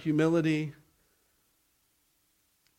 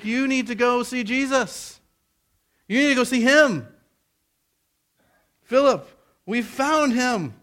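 eager urging; 8 semitones higher, 6 dB louder than usual. Retold or imitated. Andrew urging his brother.

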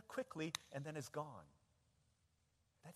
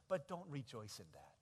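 low, soft let-down; pulling the plug; 10 semitones lower, 12 dB quieter than usual. Acted. Jesus deflating excited disciples, suddenly low and quiet. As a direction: drop right down to deflate the excitement. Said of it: "he pulls the plug on them".

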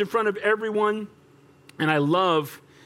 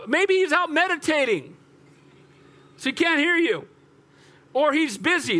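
high, sarcastic mock speculation; Elijah mocking; 8 semitones higher than usual. Prophet taunts rivals about their absent god, sarcastic. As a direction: offer excuses with mock helpfulness. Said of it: "sarcasm's coming".

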